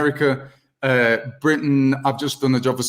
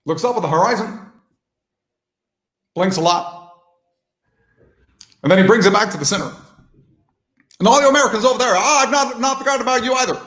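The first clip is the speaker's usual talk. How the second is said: higher, halting presidential oratory with long pauses; former president; 6 semitones higher than usual. Acted. Impressionist addressing Americans over there, former-president voice. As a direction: speak in measured bursts like a former president. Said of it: "a former president who kinda talks like this".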